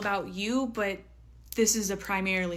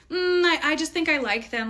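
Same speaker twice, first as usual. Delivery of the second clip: higher-pitched mock reluctance; hesitant objection; 7 semitones higher, 5 dB louder than usual. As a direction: imitate someone hedging with a drawn-in "mm". Voicing viewers' hesitant objection.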